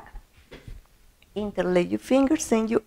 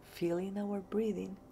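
guided meditation; 3 semitones lower, 17 dB quieter than usual. Acted. Teacher welcoming listeners to a breathing meditation, soft guided meditation voice. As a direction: ease them gently into calm, quiet and unhurried.

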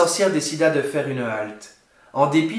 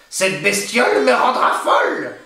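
higher, exasperated crone voice; an old hag at the end of her tether; cursing witch; 5 semitones higher, 4 dB louder than usual. A narrator's reading. Witch fed up with the crying baby, cursing crone voice. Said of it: "pesta la vieille".

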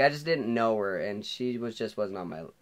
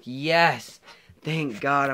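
retold cheer, higher and louder; happy relief; 5 semitones higher, 8 dB louder, much slower than usual. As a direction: celebrate being let off the hook. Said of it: "I'm all happy".

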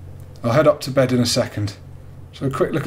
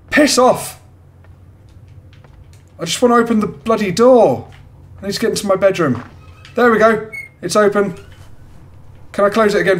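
scared pleading; 7 semitones higher than usual. Real, not acted. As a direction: beg the house to stop, rattled. Panicking in haunted house game.

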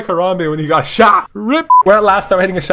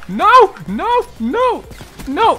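repeated high no; 11 semitones higher, much slower than usual. Real, not acted. Losing a sniper fight.

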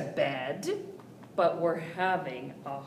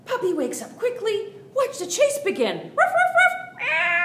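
high, loud, fast and thrilled; excited chase; 9 dB louder than usual. A narrator's reading. Narrating the puppy chasing kitty, loud excitement. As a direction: burst out like the chase is on.

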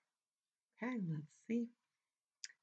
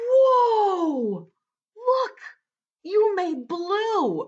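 high, loud drawn-out wonder; amazed; 10 semitones higher, 5 dB louder, much slower than usual. Acted. Storyteller to kids who made blue, high amazement. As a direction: marvel at it for young listeners.

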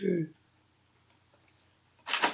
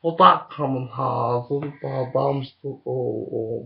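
normal talking voice

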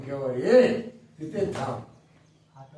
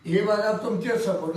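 normal talking voice

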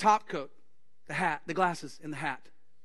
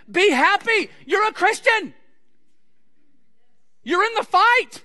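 much higher and louder, bursting with joy; exultant cheer; 8 semitones higher, 9 dB louder than usual. Retold or imitated. Pastor cheering a guilt-ridden believer, high and loud. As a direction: shout the good news like a victory cry.

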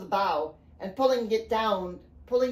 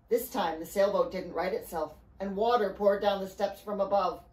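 usual speaking voice

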